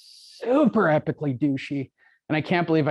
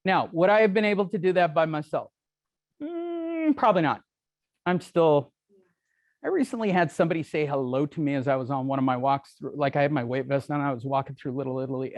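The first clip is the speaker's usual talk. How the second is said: ordinary talk, nothing marked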